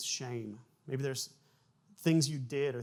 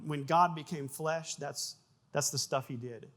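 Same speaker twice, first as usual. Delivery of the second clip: ordinary delivery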